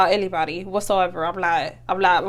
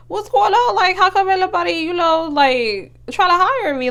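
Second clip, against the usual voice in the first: high-pitched, exasperated questioning; indignant disbelief; 8 semitones higher than usual. Retold or imitated. Recalling why nobody would hire her, high and exasperated. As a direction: demand answers in a high, incredulous voice. Voices past frustration at rejection.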